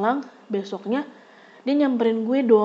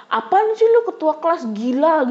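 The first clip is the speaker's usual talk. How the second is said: higher, louder sneering retort; insolent retort; 6 semitones higher, 4 dB louder than usual. Retold or imitated. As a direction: throw the insult back rudely. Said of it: "dia tuh nyolot". (nyolot dengan nada tinggi)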